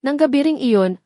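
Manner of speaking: plain reading voice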